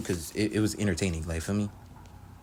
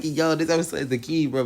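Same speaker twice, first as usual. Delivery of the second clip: laughing; 8 semitones higher, 5 dB louder than usual. Real, not acted.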